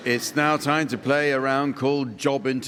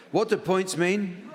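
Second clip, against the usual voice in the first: calling out; 7 semitones higher than usual. Real, not acted. Host calls out to audience.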